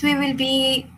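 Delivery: normal talking voice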